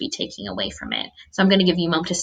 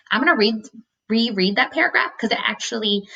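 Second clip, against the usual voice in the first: ordinary talk, nothing marked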